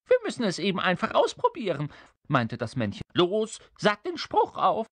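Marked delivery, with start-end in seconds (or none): 0.1-1.9 high, fast imp voice; impatient little creature. Acted. Little creature brushing off the king's doubt, impatient. 3.1-4.9 high, fast imp voice; impatient little creature. Acted. Little creature brushing off the king's doubt, impatient.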